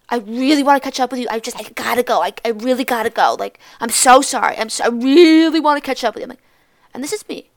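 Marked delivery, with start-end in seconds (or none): 0.1-6.2 loud, overdone apologetic pleading; gushing apology. Retold or imitated. Classmate turning round to Julia on the ramp, mock gushing apology. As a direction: stretch the "really", mock sincere.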